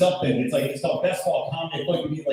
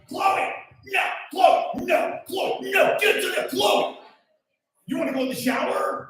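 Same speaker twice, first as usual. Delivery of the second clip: yelling at the dog. Retold or imitated. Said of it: "and I'm like".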